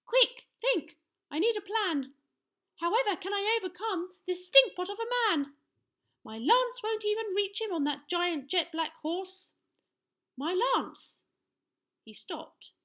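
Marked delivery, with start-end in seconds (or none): 0.1-9.5 put-on high, loud urgent boy voice; panicking little knight. A narrator's reading. Young knight needs a plan against the giant, panicking. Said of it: "gulped Sir Scallywag". 10.3-11.2 high-pitched, wondering little knight; dawning realisation. A narrator's reading. Young knight notices his lance, realisation dawning. Knight's idea begins to dawn.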